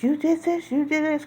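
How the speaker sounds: high-pitched accusing cry; retold accusation. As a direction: throw the blame at him, pitch up. Accusing him while in labor.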